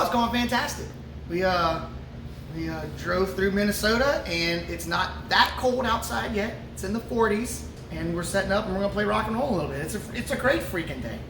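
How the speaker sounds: ordinary talk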